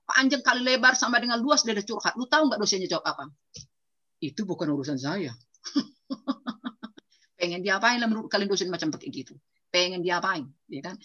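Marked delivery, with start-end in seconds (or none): 4.2-5.4 low, curt dismissive reply; cold lecturer. Retold or imitated. Lecturer dismissing the older student's plea, low and curt. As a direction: flatly shut the student down.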